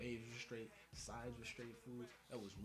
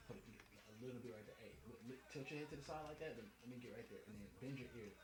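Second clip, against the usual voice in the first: barber murmuring; 6 dB quieter, faster than usual. Retold or imitated. Barber quietly guiding the customer's head. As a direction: murmur directions while cutting.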